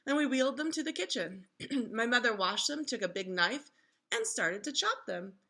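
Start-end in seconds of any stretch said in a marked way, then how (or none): none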